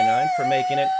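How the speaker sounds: high, fast, loud cheer; excited shout. Real, not acted. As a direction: burst out with excitement. Excited announcement.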